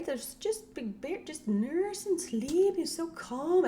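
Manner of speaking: high, slow imitation of a doting parent; imitated parent's high, lingering delight; gushing parent. Voicing parents praising calm baby.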